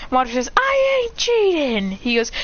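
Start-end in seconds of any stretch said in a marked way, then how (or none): 0.5-1.9 high, drawn-out protest; slow, high indignant yell; yelled denial. Acted. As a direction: squeal back in a gaming quarrel. Shouting match over video game.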